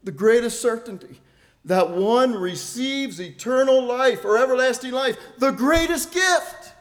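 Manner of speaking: impassioned pulpit peak; fervent preaching. Acted. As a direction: lift the congregation with each greatest.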